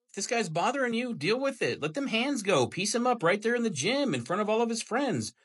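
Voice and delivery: mock pep talk, swooping high pitch; sarcastic. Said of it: "Obviously, I'm being sarcastic".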